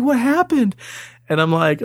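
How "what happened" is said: high concerned mother voice; worried mom. Retold or imitated. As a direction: ask like an anxious mom. Mom asking crying child.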